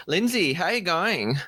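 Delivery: bad mock Australian greeting; jokey fake Australian accent; terrible Australian accent. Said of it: "speak in terrible Australian accents".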